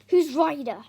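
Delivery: loud, sharp snapped question; angry demand. Acted. Dad snaps at Tom, demanding who Ryder is. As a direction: demand it hard. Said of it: "Dad snaps".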